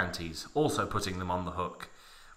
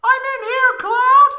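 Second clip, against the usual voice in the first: high falsetto call across the room; high Tifa voice calling; 13 dB louder than usual. Acted. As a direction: call out in a much higher girlish voice.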